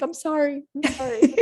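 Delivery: high, apologetic mock sorry; flustered apology. Acted apologizing while crying.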